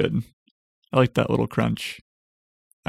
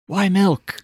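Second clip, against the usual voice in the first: higher, exasperated why milk; puzzling over the milk trademark, asks again higher; higher incredulous repeat; 8 semitones higher than usual. Real, not acted.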